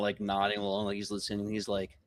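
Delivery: ordinary talk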